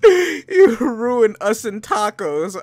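laughing. Real, not acted. Laughing while reading.

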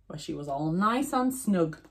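plain reading voice

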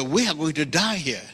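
ordinary talk

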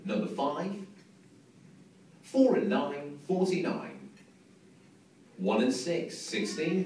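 slow, drawn-out bingo number calls; bingo caller. Acted. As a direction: call each number out, pausing between. Calling bingo numbers to hall.

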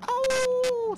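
startled yelp. Real, not acted. Startled by a wolf.

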